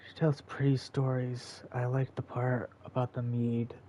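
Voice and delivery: a soft character read; soft put-on voice. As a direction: read it gently, like a tale. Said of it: "read it in my normal voice".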